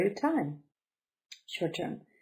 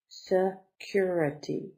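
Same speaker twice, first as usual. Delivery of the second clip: Security slowly broken up, se, then curity; slow teaching breakdown of Security; syllable by syllable; slower than usual. Shown as a demonstration. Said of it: "Four syllables".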